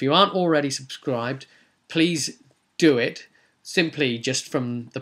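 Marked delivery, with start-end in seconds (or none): none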